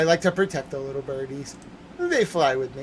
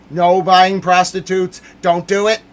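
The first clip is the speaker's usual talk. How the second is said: yelled warning; 3 semitones higher, 9 dB louder than usual. Real, not acted. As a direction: bellow the command at the viewers. Streamer yelling warning at viewers.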